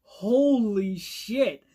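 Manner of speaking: very slow, stretched-out disbelief; slow, emphatic astonishment; stunned shock. Retold or imitated. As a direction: reenact a jaw-dropped reaction, drawing out each word. Reenacts own shocked reaction.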